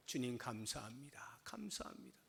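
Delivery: hushed, breathy thanks to the Lord; soft whispered gratitude; whispered prayer. Acted. Voicing a grateful prayer.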